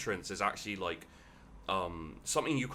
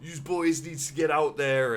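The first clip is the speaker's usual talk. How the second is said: loud, higher barked orc order; orc boss yelling; 9 semitones higher, 10 dB louder than usual. Acted. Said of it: "yelling, he's like".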